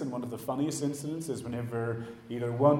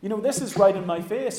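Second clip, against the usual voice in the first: higher-pitched, exasperated appeal; annoyed; 6 semitones higher, 3 dB louder than usual. Acted. Frustrated believer complaining to Jesus, annoyed. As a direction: complain as if fed up with waiting. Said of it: "That gets us really annoyed 'cause we're like".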